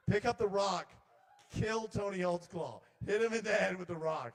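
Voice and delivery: loud, high-pitched urging, words stretched out; commanding intrusive thought. Acted. Intrusive thoughts egg on a fishing friend, loud command.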